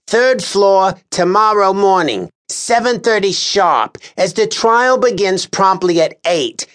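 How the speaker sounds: high, commanding call to the room; officious Lars Kern. A narrator's reading. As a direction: call the jurors to order. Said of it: "Kern dismisses the jury".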